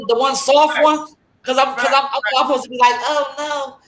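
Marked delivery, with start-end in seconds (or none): 3.0-3.8 mock meek apology. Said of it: "who the fuck talks like that".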